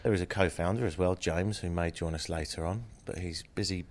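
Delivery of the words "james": ordinary talk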